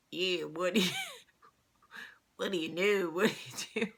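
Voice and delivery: imitating a failed Italian Jersey accent; badly attempted Jersey accent. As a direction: botch an Italian Jersey accent, as a clumsy mimic. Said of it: "tried to do the Italian, like, Jersey accent".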